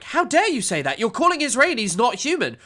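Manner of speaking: mock-outraged interviewer, pitched high; high-pitched mock indignation; Piers Morgan. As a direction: imitate an indignant host, high swooping pitch. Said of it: "Piers would have been like".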